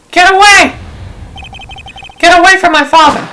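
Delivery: high, loud, desperate scream; child's scream. Acted. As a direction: scream to save his father. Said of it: "And with that scream".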